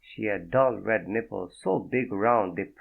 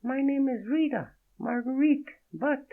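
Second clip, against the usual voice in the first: high, breathy-free French woman's voice, much higher; a startled French woman, voiced high; French accent. Naked woman startled by Fredo, in a high French accent. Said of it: "The accent was real".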